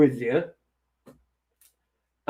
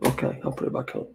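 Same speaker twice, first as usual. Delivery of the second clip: softer, fast, almost whispered; muttered aside; 5 semitones lower, 11 dB quieter, faster than usual. Real, not acted. A quick embarrassed mutter to himself after nobody agrees. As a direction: mumbles it under his breath while backing down. Joking about looking sexy, gives up.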